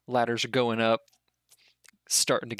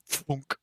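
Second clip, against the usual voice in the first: whispery mouthed thunk; airy catapult sound effect; imitated trebuchet launch. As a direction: mimic a rock being flung, all breath.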